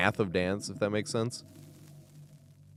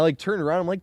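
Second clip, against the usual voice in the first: usual speaking voice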